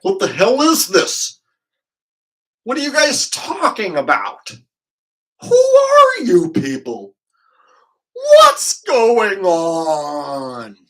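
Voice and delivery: loud, bewildered outburst that breaks into a high whisper; a lost stranger who can't believe what he's walked into; confused newcomer. Pretend. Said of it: "we get some new folks stumbling in here like".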